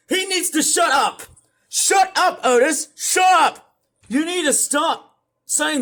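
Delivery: high, loud yelling to shut him up; fed up, shout him down hard; angry shouting. Real, not acted. Streamer yelling at Otis to stop, furious shouting. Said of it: "I'm sorry if I'm sounding angry there".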